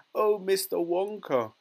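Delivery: high, loud, pleading mother; wailing. A narrator's reading. Mother implores Wonka for help, wailing. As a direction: wail it imploringly. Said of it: "wailed Missus Teavee".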